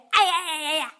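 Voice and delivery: mock yelp of pain. Fox yelping as the duckling pecks his tongue. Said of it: "狐狸疼得张大了嘴".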